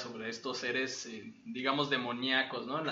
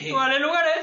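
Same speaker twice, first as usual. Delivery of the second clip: excited shout; 12 semitones higher, 9 dB louder than usual. Real, not acted. Excited outburst.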